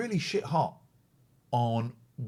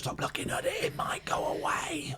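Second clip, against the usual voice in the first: mock whisper; 5 dB quieter than usual. Mock superstition about viewer count.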